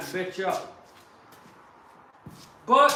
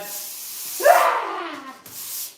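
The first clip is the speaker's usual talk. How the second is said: loud high cackle; 13 semitones higher, 5 dB louder than usual. Real, not acted. Laughing about the angry liberals, a loud high cackle. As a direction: cackle at the taunt.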